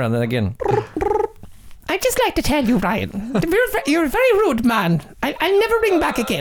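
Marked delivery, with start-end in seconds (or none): 1.9-6.4 high-pitched put-on caller voice; offended caller. Host brings back the caller to scold co-host, offended high voice. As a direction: pipe up high as a huffy caller. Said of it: "Bring her back".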